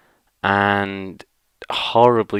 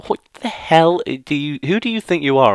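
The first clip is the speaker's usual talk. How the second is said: acted outrage; 7 semitones higher than usual. Viewer challenging the big guy on the train, acted outrage. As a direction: challenge him in appalled disbelief, pitch up.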